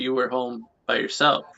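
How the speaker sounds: normal talking voice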